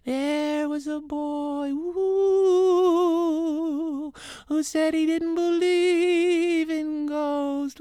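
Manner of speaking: spooky ghost voice. Acted. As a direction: moan it like a little ghost. Ghost tells a ghost story.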